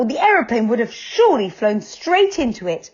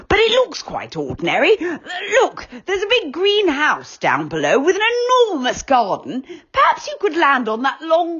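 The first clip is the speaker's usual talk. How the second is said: higher, fretful little Moonface voice; worried Moonface; 8 semitones higher than usual. Acted. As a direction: fuss like an anxious friend. Moonface guides the landing.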